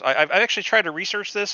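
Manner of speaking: ordinary talk, nothing marked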